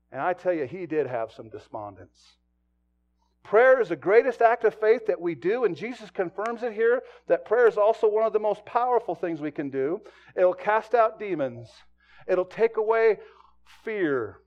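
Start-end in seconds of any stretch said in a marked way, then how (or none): 3.4-14.4 loud, driving sermon delivery; full-force preaching. Acted. Pastor preaching hard to his church, loud and driving.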